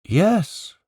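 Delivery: a distant shout pitched high; faraway shout. Acted. Children answering from the tower, a distant high shout. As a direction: call back from far away. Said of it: "shouted four faraway voices".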